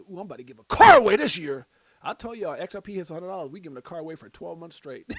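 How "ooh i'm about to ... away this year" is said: excited exclamation. Retold or imitated. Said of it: "I said".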